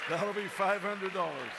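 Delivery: laughing through the punchline; cracking up while telling it; loud, high words breaking into laughter. Real, not acted.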